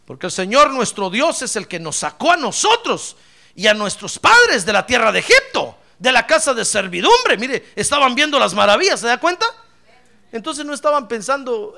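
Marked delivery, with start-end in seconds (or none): 0.0-7.4 loud, raised proclamation of a crowd; people's emphatic declaration. Acted. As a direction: declaim it forcefully as a people's vow. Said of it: "el pueblo le respondió y le dijo". (proclamación enérgica del pueblo)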